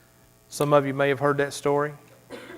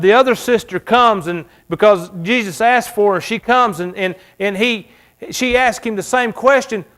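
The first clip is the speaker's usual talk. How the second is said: high, loud preacher's cry; preaching at full force; 7 semitones higher, 6 dB louder than usual. Acted. Pastor to congregation, preaching loud and high. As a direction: lift the church with the story.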